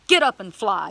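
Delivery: loud, firm send-off; commanding. Retold or imitated. Believer ordering a healed bird to fly, commanding. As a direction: command it forcefully to take off. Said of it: "started commanding the bird".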